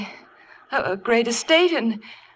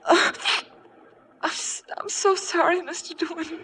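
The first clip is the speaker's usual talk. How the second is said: tearful apology; 9 semitones higher, 4 dB quieter than usual. Acted. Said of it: "Here, blow your nose".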